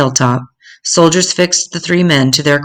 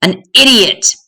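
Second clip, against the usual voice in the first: higher, spat-out insult; mock shriek; 6 semitones higher, 3 dB louder than usual. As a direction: sneer the word at him. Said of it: "Justus shrieked".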